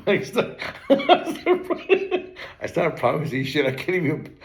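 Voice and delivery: real giggles breaking the sentence; laughing confession. Real, not acted. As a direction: try to confess while cracking up. Laughing at own false promises.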